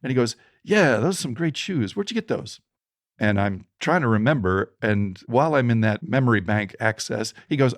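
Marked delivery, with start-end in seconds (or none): 0.6-2.6 voicing the panhandler. Panhandler voiced in retold story.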